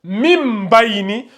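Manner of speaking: "mim bayni" with a long m before b; qalb pronunciation. Shown as a demonstration. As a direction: draw out "mim" slowly into "bayni". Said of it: "Ça se prononce". (« mim bayni » avec un m prolongé)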